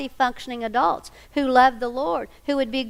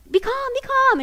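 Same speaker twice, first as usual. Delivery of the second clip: mock soothing; 14 semitones higher, 3 dB louder than usual. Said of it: "And I'm going".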